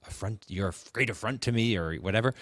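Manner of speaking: ordinary delivery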